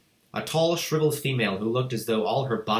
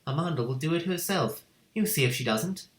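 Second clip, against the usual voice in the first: Matilda's voice; 7 semitones higher than usual. A narrator's reading.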